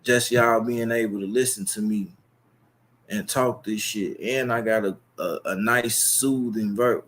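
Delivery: ordinary delivery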